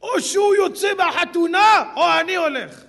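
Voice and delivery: shouting an ultimatum; mock shout. Guest threatens to leave the wedding, loud. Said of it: "מה הוא פתאום אומר".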